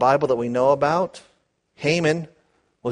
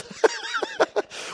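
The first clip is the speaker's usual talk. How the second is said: laugh; 4 dB quieter than usual. Real, not acted. Laugh while reading blessing.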